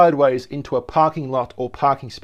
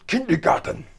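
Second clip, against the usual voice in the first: mock German accent, pitched up; hammed-up angry German on a single word; 5 semitones higher, 4 dB quieter than usual. Presenter repeating a German word to viewers, angry German. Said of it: "Sound like an angry German".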